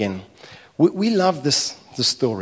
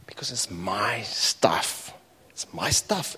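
hushed resentful mutter; 5 semitones lower, 9 dB quieter, slower than usual. Acted. Older brother voiced, resentful.

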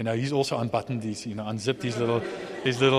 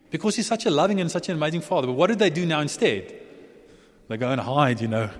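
normal talking voice

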